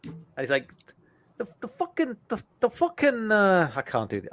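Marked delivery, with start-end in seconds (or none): 1.4-3.7 a higher mock Boston rant; lightly done Boston comic's griping; Bill Burr impression. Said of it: "I'll try and do the accent".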